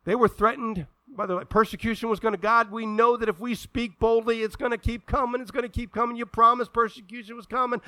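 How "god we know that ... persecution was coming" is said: loud, high, urgent plea to God; pleading prayer voiced for the disciples; fervent prayer. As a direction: cry out to God with rising urgency.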